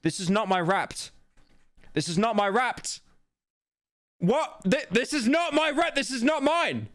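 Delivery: building from mild protest to high, incredulous denial; rising disbelief. Real, not acted. Insists the Wrapped isn't his.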